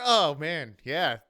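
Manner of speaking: ordinary talk, nothing marked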